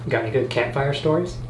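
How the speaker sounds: usual speaking voice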